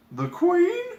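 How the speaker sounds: put-on high girlish gasp of shock. A narrator's reading.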